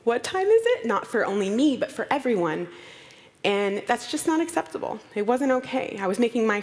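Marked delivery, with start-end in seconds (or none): 0.0-0.8 much higher, airheaded and lost; ditzy. Retold or imitated. Admitting she never knows the time, ditzy squeak. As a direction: play the scatterbrain. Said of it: "that ditzy person who's just like".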